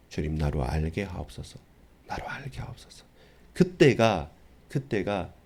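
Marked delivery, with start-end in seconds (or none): 0.1-1.6 soft prayer. Acted. Voiced prayer of the humble. 2.1-3.0 whispered plea to God; whispered prayer. Acted.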